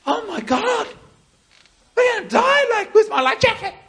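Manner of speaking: very high, loud hammed-up panic; mock-panicked Jesus. Jesus imagined panicking in the storm, hammed-up hysteria. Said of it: "he goes".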